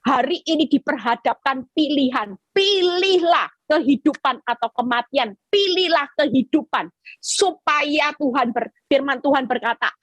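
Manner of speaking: ordinary delivery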